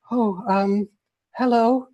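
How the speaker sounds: put-on shy girl caught off guard; nervous. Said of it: "she gets nervous again".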